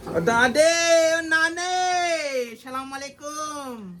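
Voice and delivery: high, long-drawn holler; calling out greeting. Real, not acted. Man greets the elders after climbing down, drawn-out high call.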